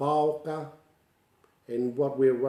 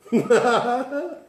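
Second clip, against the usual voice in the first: high burst of laughter; 11 semitones higher, 7 dB louder than usual. Real, not acted. Laughing at the story.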